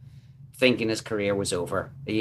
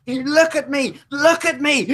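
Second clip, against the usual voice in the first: high, loud, slow anguished wail; wailing Bobby; 5 dB louder than usual. Retold or imitated. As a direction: wail at your friend in horror. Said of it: "And Bobby's going".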